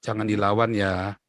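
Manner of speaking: lightly voiced calming father; a hint of a father's gentle warning; father's counsel. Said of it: "ayah saya selalu bilang apa?".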